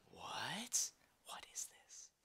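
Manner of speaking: breathless whisper of disbelief; shocked whisper. Retold or imitated. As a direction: hush it out, spooked. Whispering in shock at the vanished shadow.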